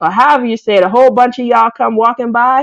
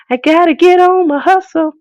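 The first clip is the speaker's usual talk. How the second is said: higher, punchier repeat of the motto; hype-woman chant; hyped self-pep voice; 7 semitones higher than usual. Shown as a demonstration. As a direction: pump yourself up on it. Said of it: "but I gotta get on my hustle".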